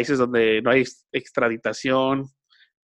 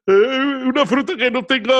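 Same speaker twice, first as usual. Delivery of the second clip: mock chain-post voice; 11 semitones higher, 5 dB louder than usual. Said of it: "lo que me está cagando la vida es de repente".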